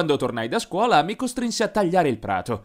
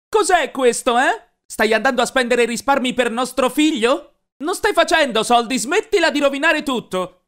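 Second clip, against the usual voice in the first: higher, louder enraged accusation; furious yell; 10 semitones higher, 4 dB louder than usual. A narrator's reading. Mom confronts Glenn with the stolen savings, screaming angrily. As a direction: wave the money and scream at him. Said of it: "Glielo sventolò in faccia con rabbia".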